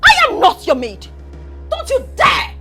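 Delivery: loud, high-pitched screaming defiance; furious tirade. Acted. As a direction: yell it, refusing to be bossed around. Tirade against being ordered around.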